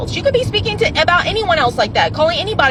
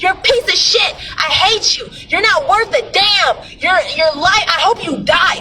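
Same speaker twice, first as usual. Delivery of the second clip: mock hateful tirade; 6 semitones higher than usual. Shown as a demonstration. Demonstrating cursing the bottle like an ex, in a raised mock tirade. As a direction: rant at the bottle like at a hated ex. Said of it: "speak to that water bottle like that".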